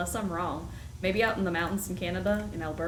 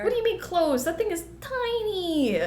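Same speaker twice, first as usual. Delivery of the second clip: can't believe they called it close; indignant surprise; 12 semitones higher, 4 dB louder than usual. Real, not acted. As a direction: gasp then high, loud outrage. Outraged at fish game verdict.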